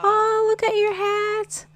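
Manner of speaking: squealing adoration. Real, not acted. Cooing at new toddler.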